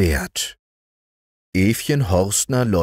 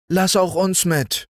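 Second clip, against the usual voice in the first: put-on higher, pleading girls' voice; girls pleading; 12 semitones higher than usual. Sisters begging to go to Eva, pleading. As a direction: beg to come along. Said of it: "baten die Mädchen".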